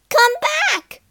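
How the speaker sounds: Troll Queen shrieking after the fleeing bear; crying out. A narrator's reading. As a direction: scream after them. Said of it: "she cried".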